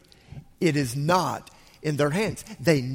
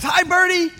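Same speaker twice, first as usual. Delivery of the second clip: put-on much higher and louder childish call; squeaky little voice; 13 semitones higher, 7 dB louder than usual. Littlest guy calls to the bird in a tree, squeaky. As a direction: greet the bird brightly like a little kid. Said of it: "the littlest guy goes".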